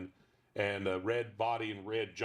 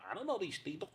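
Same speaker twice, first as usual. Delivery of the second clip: high, pinched dog with attitude; mock the man like a snotty dog; high squeezed puppet voice; 10 semitones higher, 4 dB quieter than usual. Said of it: "can you do the voice".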